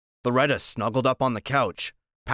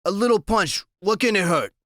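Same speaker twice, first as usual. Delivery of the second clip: nervous; 8 semitones higher, 3 dB louder than usual. Acted. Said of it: "he sounds real nervous".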